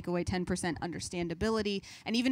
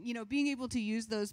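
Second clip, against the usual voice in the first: ordinary delivery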